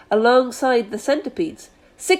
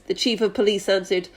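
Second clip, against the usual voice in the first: usual speaking voice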